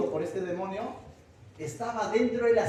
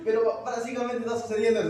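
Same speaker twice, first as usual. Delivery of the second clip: ordinary talk, nothing marked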